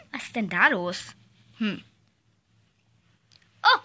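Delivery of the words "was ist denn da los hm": much lower, softer teacher voice; stern teacher. Acted. Teacher asks the noisy kids what's going on, deep and quiet. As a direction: sink deep into a grown-up's question.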